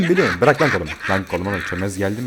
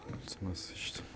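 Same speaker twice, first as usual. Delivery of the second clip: breathless whisper, barely there; scared whisper; 17 dB quieter, much slower than usual. Real, not acted. Player hiding from enemies, whispering in fear. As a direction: hush it so nobody hears.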